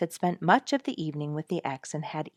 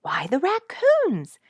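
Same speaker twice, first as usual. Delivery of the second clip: put-on excited child; 11 semitones higher, 7 dB louder, slower than usual. A narrator's reading. Child reveals the news.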